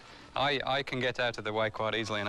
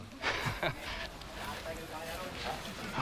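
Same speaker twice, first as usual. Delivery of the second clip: amazed laugh; 6 semitones higher, 8 dB quieter than usual. Real, not acted. Amazed at huge crocodile.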